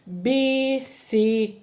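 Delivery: bicicleta spoken slowly, syllable by syllable; syllables drawn out. Shown as a demonstration. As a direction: spell out bi-ci-cle-ta by sound. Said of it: "Quatro sílabas". (bicicleta silabada devagar)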